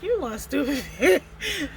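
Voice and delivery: slow, breaking into laughter mid-line; retold insult dissolving into laughter; laughing through the insult. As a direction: retell the insult, cracking up. Repeat broken up by laughter.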